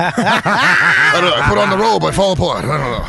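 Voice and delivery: self-pitying comic, sinking as he goes; Rodney Dangerfield bombing. Acted. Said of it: "That's a good Rodney".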